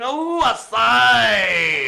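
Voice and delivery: loud, high, long-held cry; drawn-out wail. Pretend. Host calling to Jackie watching, loud held wail. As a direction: belt it out slowly, breaking into laughter.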